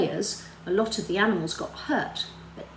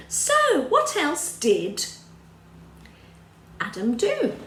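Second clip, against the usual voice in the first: drawn-out question; 7 semitones higher, 4 dB louder than usual. Acted. Teacher drawing out a question.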